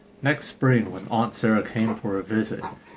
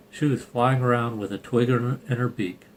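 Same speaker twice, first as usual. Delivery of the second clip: ordinary delivery, nothing marked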